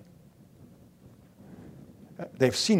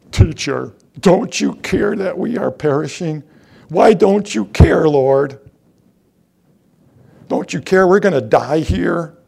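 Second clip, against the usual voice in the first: desperate plea; 3 semitones higher, 7 dB louder than usual. Acted. Disciples cry out in the storm.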